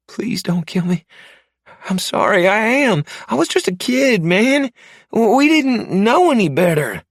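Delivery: high, panicked pleading voice, fast and loud; terrified captive man. Acted. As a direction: beg for your life in a frantic high voice. I want you to beg.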